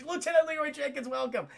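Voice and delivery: mock British accent, pitched high; hammed-up British greeting; Leia. Leia greeting an officer, mock British accent. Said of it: "She has a British accent".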